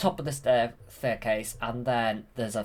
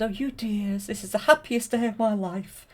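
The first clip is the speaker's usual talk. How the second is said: higher, lightly voiced princess; 10 semitones higher than usual. Aurora thanking the fairies, in a higher princess voice. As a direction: lift into a princess voice for her. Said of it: "And she says".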